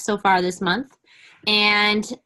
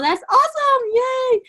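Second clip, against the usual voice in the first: excited cheer. Real, not acted. Cheering team total.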